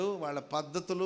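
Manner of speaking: ordinary talk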